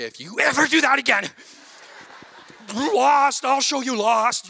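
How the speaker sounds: hammed-up angry dad scolding; stern threat. Dad scolding his son around a corner, mock fury. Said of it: "take him around a corner and say".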